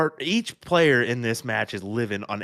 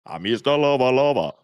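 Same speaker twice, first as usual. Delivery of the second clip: louder put-on reggae singer voice; 2 semitones higher, 5 dB louder than usual. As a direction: lay on the Jamaican swagger. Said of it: "He does a great Shaggy impersonation".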